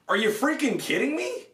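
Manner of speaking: can't believe how flimsy this armor is; exasperated disbelief. Real, not acted. Reviewer fed up with his flimsy armor, exasperated disbelief. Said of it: "this is really frustrating".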